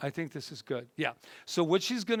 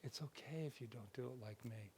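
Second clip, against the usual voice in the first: soft gentle version; 3 semitones lower, 18 dB quieter than usual. Shown as a demonstration.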